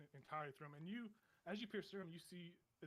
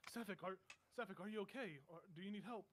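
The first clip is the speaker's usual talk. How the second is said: lightly voiced woman, a little higher; woman calling out; 4 semitones higher than usual. Woman calls into the fading vision, concerned call. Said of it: "another woman's voice call out".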